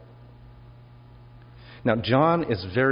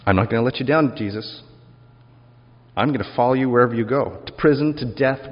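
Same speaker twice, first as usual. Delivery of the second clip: eager promise; 2 semitones higher, 5 dB louder than usual. Acted. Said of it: "Peter insists loudly and emphatically".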